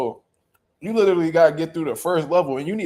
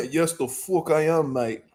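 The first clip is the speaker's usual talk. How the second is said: drawn-out, softer mock British accent trailing off; British accent, slower; 3 dB quieter, slower than usual. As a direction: relish it in a slower British accent, almost laughing. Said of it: "I'm like".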